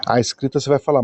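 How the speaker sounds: ordinary delivery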